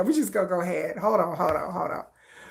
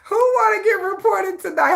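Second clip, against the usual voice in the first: gleeful mock shout; 8 semitones higher than usual. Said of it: "I'm like".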